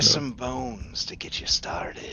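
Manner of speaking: breathy sly whisper; whispered skeleton voice. Acted. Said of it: "the skeleton says".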